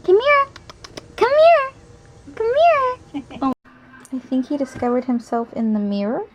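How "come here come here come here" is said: high, loud puppy calling. Real, not acted. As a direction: call the puppy in sing-song.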